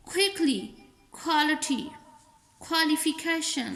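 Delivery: keyboard, kindly, quickly, quality, qualification, queen begun with khe; aspirated k. Shown as a demonstration. Teacher drilling English words word by word, aspirated k. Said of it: "खे से निकालना है".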